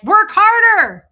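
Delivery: high, loud, slow order; mock yell. People in The Devil's Advocate driving her on, loud mock yell. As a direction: yell the order like a slave driver. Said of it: "They're like".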